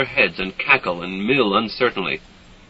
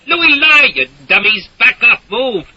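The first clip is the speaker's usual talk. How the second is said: shouting orders; 12 semitones higher, 7 dB louder than usual. A narrator's reading. Herding villagers at gunpoint.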